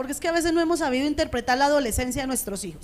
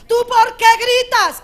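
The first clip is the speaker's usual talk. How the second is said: high, loud parental yell; shouted scolding; 7 semitones higher, 8 dB louder, slower than usual. Acted. Parent telling off a child for shouting, shouted scolding. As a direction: yell the question while accusing the child of yelling. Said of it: "¿Quién es el primero que está gritando?".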